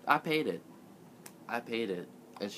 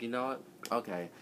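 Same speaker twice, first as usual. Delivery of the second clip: resigned quiet okay; 3 semitones lower, 9 dB quieter than usual. Retold or imitated. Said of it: "I was just like".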